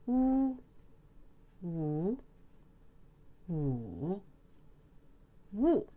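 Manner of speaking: Wu flat, then rising, then dipping, then falling sharply; four-tone Wu drill; four tones in a row. Shown as a demonstration. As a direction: run each Wu through the four tones in order. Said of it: "four together".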